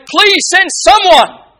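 high, loud, slow anguished cry of please send someone; desperate plea. Retold or imitated. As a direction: cry it out like a man burning in fire, begging. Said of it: "This man would plead with all of the energy".

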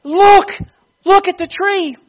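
very high, loud acted shout; excited calling out. Preacher calling the boy to look at the tree, excited shout. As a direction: call out urgently, pitched right up. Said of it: "Ravensbury called to the boy".

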